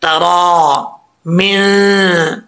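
तरा मिन said very slowly, the या after रा not read; slow recitation. Shown as a demonstration. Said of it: "ये नहीं पढ़ेंगे". (तरा मिन बहुत धीरे, या नहीं पढ़ना)

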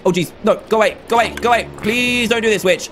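ordinary talk, nothing marked

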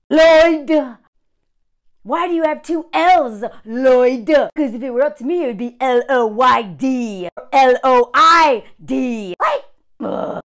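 worked up. Real, not acted. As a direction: shout the name in mock outrage at the spelling. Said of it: "I'm getting really worked up".

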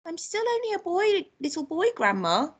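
put-on high little-boy voice; pleading boy. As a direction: plead upward as a small child.

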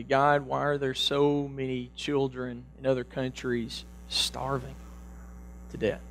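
put-on soft lament to God about starving children; hushed prayer. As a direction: sink into a quiet lament.

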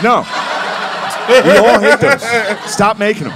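half-laughing shout of protest; exasperated friend. Retold or imitated. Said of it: "It's like".

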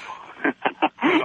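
laughing. Real, not acted. Caller laughs at radio warning.

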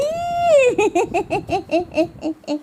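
high squealing giggle. Real, not acted. Mother giggling during peekaboo, squealing.

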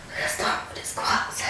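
unvoiced, soft whisper. Real, not acted. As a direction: whisper it to the camera. Repeats line in a whisper.

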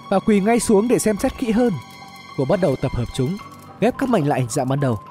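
plain reading voice

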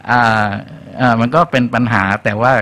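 usual speaking voice

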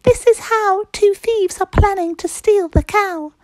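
very high, loud tiny-creature voice; high-pitched ladybird alarm. Acted. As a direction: squeak it out like a little bug. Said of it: "told the animals".

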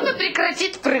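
usual speaking voice

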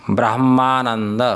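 ब्रह्मानंद spoken slowly, each sound distinct; careful demo. Shown as a demonstration. Teacher models ब्रह्मानंद slowly for his students. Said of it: "इसी प्रकार से जैसे देखो".

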